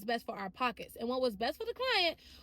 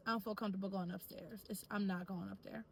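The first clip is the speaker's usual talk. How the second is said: low, softer, composed refusal; quietly confident; 4 semitones lower, 6 dB quieter than usual. Shown as a demonstration. Practising how to decline going upstairs with a client, confident and calm. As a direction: set the boundary calmly, without rudeness. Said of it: "be able to confidently say".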